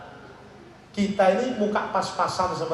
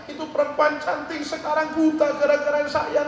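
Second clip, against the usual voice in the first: high-pitched retold lament; acted tearful remorse; 8 semitones higher than usual. Repentant Hasan blames himself for her blindness, high tearful lament. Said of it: "nangis, taubat dekat Allah".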